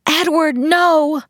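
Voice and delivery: high, urgent cry of protest; desperate scream. A narrator's reading. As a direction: cry out to stop someone, desperately. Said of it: "I screamed".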